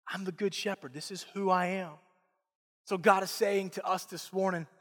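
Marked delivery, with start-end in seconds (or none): 0.1-1.9 soft reassurance. Acted. Softened repeat of God's words.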